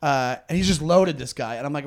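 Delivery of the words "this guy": ordinary talk, nothing marked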